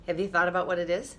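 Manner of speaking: ordinary delivery, nothing marked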